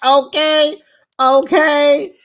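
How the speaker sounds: high, loud delighted okays; excited cheer. Real, not acted. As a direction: cheer it like you loved the burn. Viewer reacts to the dig.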